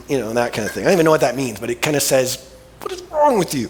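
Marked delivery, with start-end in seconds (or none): 2.8-3.7 high, exasperated mama scolding; Italian mama scolding. Retold or imitated. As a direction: scold like an old Italian mother. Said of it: "giving the old Italian mama thing".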